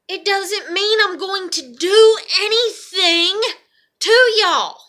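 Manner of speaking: loud, high, slow emphasis; exasperated protest. Real, not acted. Vlogger telling viewers she's no danger, exasperated loud protest.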